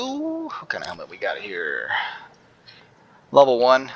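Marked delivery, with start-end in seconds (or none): 0.0-1.9 whispering. Real, not acted. Whispered aside while looting.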